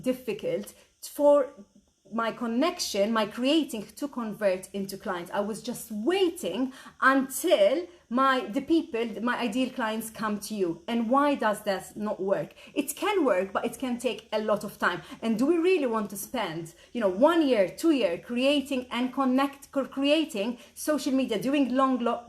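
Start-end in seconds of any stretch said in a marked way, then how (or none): none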